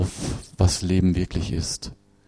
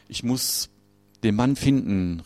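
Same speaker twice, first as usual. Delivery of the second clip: ordinary talk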